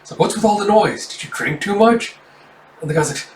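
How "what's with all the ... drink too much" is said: high-pitched, put-out questioning; startled complaint. Acted. Hideout man to someone bursting in, acted startled complaint. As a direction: demand the reason, voice pitched up.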